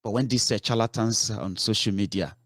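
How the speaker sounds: ordinary talk, nothing marked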